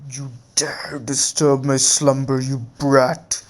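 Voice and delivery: slow, drawn-out beast voice; awakened monster rebuking an intruder; menacing Ninetales. Retold or imitated. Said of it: "the Ninetales was like".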